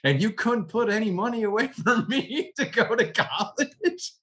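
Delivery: retelling how he scolded his mom over the vacuum cleaner, mock indignant and laughing; indignant retort. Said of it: "I said".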